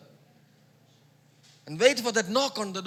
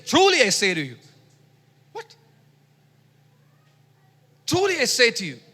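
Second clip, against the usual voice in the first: louder, stressed "Truly"; solemn emphatic quotation; emphatic quote; 3 semitones higher, 6 dB louder than usual. Retold or imitated. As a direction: quote Jesus with weight. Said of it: "It's a double emphasis".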